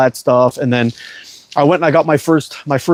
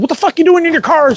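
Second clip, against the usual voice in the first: much higher, mock-scolding wife's voice; imitating his scolding wife; 13 semitones higher than usual. Wife scolding him for driving himself to the hospital, high mock scold. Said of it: "She said".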